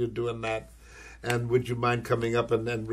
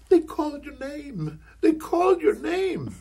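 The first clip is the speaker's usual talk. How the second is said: much higher, urgent nudging repeat; excited wife; 4 dB louder than usual. Retold or imitated. Wife urging her husband at the Oscars, high and urgent. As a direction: hiss it at him, she's thrilled. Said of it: "my wife is going".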